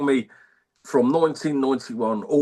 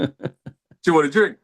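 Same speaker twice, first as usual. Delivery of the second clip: cheesy chat-up line; 8 semitones higher, 4 dB louder than usual. Pretend. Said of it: "what's your best shout out line".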